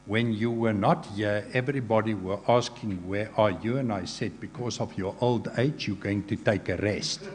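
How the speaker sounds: ordinary talk, nothing marked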